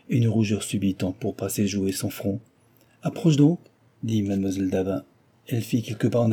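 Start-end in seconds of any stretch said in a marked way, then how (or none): 3.0-3.6 higher, prodding call to come closer; brisk little nudge; urging. A narrator's reading. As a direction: coax a hesitant child forward. Headmistress urges shy girl forward.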